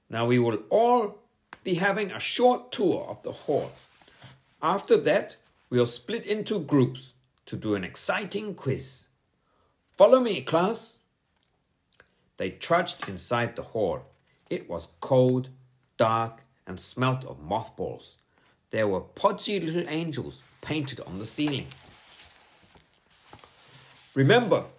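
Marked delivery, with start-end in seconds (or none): 0.1-11.0 high, firm schoolteacher voice; a strict teacher scolding a pupil; stern teacher. A narrator's reading. Teacher warning Bertie to sit down, stern and high. Miss Boot's voice. 24.1-24.7 higher, louder firm caution; warning. A narrator's reading. Teacher warning the class before the tour, high and firm. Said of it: "warned Miss Boot".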